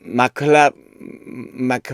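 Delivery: ordinary talk, nothing marked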